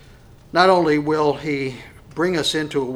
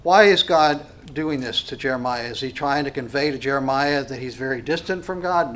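usual speaking voice

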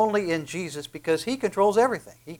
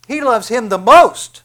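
much louder and higher, driving home "the most"; emphatic; 6 semitones higher, 12 dB louder than usual. Shown as a demonstration. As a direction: proclaim it with full force. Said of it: "would probably be the emphasis".

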